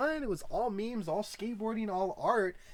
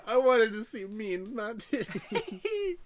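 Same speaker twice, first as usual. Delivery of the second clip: mock whiny quote; 10 semitones higher, 6 dB louder, much slower than usual. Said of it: "I don't think I've ever heard anyone say".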